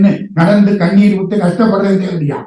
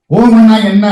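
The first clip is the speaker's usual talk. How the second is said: higher, louder demand; sharp challenge; 4 semitones higher, 7 dB louder than usual. Acted. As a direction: cut in and challenge him. Murugan interrupts and questions Brahma.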